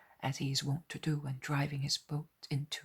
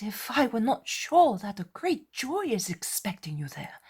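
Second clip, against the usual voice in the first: higher, louder, coaxing voice; encouraging brother; 9 semitones higher, 7 dB louder than usual. A narrator's reading. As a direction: urge him gently on. Andrew urging his brother on.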